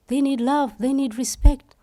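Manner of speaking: ordinary talk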